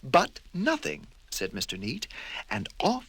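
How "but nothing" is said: higher; brooking no argument; fussy firm retort; prim overruling. Acted. Mister Neat overrules.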